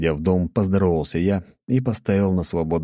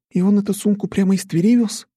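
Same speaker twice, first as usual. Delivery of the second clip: high, loud amazed question; surprised; 4 dB louder than usual. A narrator's reading. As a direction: ask in disbelief. Nastya amazed, continuing.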